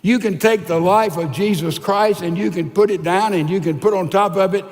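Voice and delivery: ordinary talk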